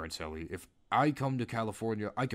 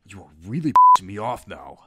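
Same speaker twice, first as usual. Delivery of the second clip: loud furious blow-up; angry outburst; 17 dB louder than usual. Retold or imitated. Creep yelling at Ellie for refusing him, loud angry outburst. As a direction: yell at her because she still won't do it. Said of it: "But this really angered him".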